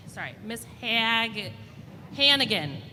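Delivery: ordinary delivery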